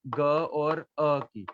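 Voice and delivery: ग और अ की said very slowly; slow sound demo. Shown as a demonstration. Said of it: "आप बोलेंगे तो आपको पता चलता रहेगा किसकी आवाज आ रही है". (धीरे-धीरे ध्वनि तोड़ना)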